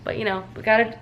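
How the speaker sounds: ordinary talk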